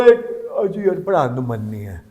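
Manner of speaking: low, soft, weary-sounding groan of words; reluctant teacher. Acted. Teacher arriving with no wish to teach, reluctant lazy delivery. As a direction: let the words sag lazily.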